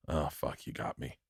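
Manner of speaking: low, soft, caught-out reply; retold defeated admission. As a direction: let it sink to a near-murmur.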